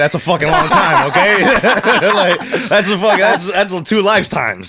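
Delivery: laughing while protesting. Real, not acted. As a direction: talk through a laugh. Laughing defensive joke.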